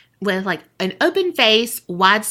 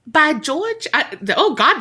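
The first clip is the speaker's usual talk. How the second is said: put-on a clumsy stab at a British accent, pitched higher; bad British accent; 6 semitones higher than usual. Friend failing at a British accent while telling a ghost story. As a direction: botch a posh British exclamation, then give up. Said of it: "that was a terrible accent".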